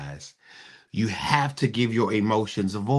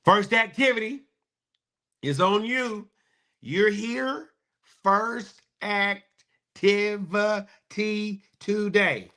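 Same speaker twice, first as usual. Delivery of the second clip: hype announcement; 11 semitones higher than usual. Real, not acted. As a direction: announce it like a host pumping the crowd. Hyping the show's first activity.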